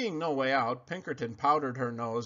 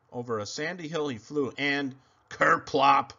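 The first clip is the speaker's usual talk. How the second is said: ordinary delivery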